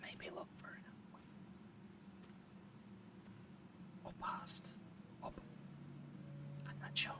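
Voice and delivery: hushed whisper so the enemy won't hear; tense stealth whisper. Real, not acted. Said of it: "you guys can hear me whispering".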